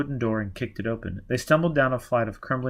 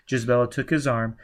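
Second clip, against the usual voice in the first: plain reading voice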